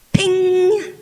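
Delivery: high, loud ringing ping; imitated ping. Pellet striking the metal clothesline, sharp high ping. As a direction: ring out like a pellet striking wire.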